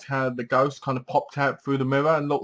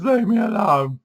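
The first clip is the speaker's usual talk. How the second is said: put-on girl's voice; 8 semitones higher, 4 dB louder than usual. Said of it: "She goes".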